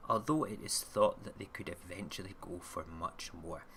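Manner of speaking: ordinary delivery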